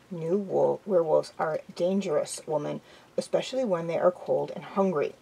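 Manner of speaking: usual speaking voice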